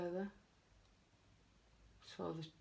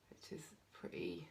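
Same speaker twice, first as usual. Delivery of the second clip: whispering; 9 dB quieter than usual. Real, not acted. Quiet aside while painting.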